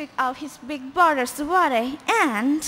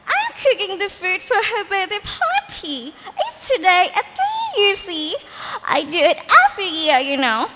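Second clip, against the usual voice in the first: higher, louder giddy witch; excited witch voice; 5 semitones higher, 5 dB louder than usual. Acted. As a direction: gush as the cackling witch. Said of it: "she cried".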